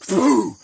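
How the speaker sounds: loud explosive boom; sudden punched sound effect; imitated impact boom. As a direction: blast it out like a body slam.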